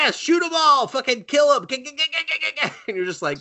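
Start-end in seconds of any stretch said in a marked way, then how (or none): 0.1-1.7 much higher hammed-up kill order; silly high war cry; mock gunner yell. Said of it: "the helicopter's just like". 1.7-2.8 high rattling cackle; cackling laugh. Real, not acted. Cackle after kill order.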